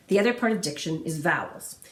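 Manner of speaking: usual speaking voice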